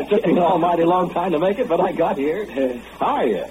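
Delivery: high-pitched, jubilant greeting; triumphant homecoming. Acted. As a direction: announce your return in a high, triumphant voice. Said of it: "all muddy and triumphant".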